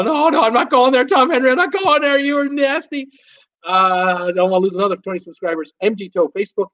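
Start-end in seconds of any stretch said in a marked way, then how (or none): 0.0-3.1 high, playful mock outrage at a cheeky comment; mock scandalized. Host teasing a naughty chat regular, mock-shocked and high. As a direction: pretend to be shocked and clutch your pearls.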